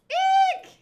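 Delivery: very high, loud eek; short piercing cartoon squeal; frightened squeal. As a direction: squeak in comic terror. Underling squeals at the threat.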